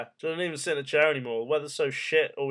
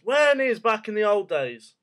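loud, high, fed-up demand; exasperated outburst; 8 semitones higher, 8 dB louder than usual. Real, not acted. Ranting at people who say the old days, loud and exasperated. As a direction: snap the question back, much louder and higher. Exasperated question after quote.

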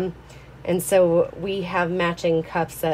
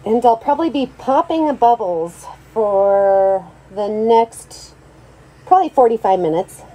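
muffled through a respirator mask; 6 dB louder than usual. Real, not acted. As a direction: talk through a face mask. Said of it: "so sorry if I sound muffled".

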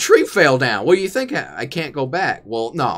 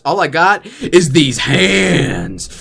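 mock dramatic; 4 semitones higher, 6 dB louder, much slower than usual. Slow dramatic showing of hands.

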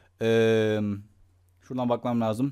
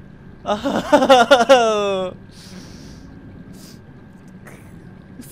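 loud laugh trailing off; helpless nervous laugh; panicked laughter; 6 semitones higher, 6 dB louder than usual. Real, not acted. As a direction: burst out laughing, then fade out.

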